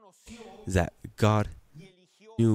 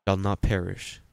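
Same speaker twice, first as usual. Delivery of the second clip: usual speaking voice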